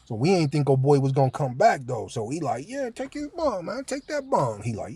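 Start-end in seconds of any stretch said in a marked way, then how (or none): 2.7-4.6 much higher, softer teasing encouragement; hammed-up sly goading; mock egging on. Inmates slyly urging the new guy to take the bunk, high teasing.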